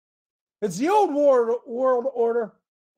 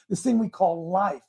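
ordinary talk, nothing marked

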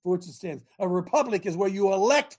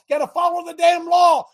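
angry shout, much higher and louder; getting angry; 8 semitones higher, 6 dB louder than usual. Real, not acted. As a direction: bark it out in real anger. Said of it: "I'm sorry if I'm getting angry".

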